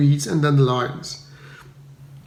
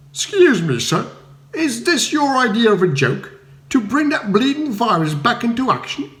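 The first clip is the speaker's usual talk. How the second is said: higher, indignant accusation; affronted villager; 8 semitones higher than usual. A narrator's reading. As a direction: demand an answer. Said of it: "a choir of expostulation".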